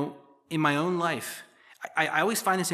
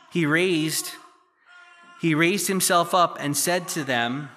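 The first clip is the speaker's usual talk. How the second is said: louder, measured Bible reading voice; scripture reading; 7 dB louder than usual. Acted. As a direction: read the passage out to the congregation. Reads the scripture passage aloud.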